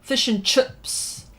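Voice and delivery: broad Kiwi "chips" said like "chups"; New Zealand accent. Shown as a demonstration. Streamer showing viewers how Kiwis say it, New Zealand accent. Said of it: "they'll say".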